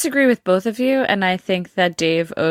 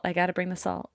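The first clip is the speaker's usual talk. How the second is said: a dropped-voice echo of the quip; softer repeat; 11 dB quieter than usual. Real, not acted. Guest to the hosts, repeating her salty quip much softer.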